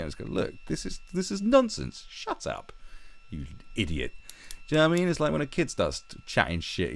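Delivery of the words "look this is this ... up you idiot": high, sneering brush-off; mock them like a silly child; mocking dismissal. Mocking the nonsense he hears, sneering and high. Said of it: "I just mock it".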